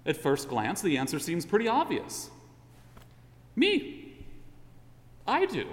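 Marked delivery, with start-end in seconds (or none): 3.5-5.7 confident. Pretend. Voicing the listener's answer.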